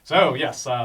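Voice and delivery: ordinary talk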